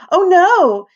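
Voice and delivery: high loud shrieking mother. Acted. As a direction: shriek it in alarm. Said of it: "shrieked Missus Zoster".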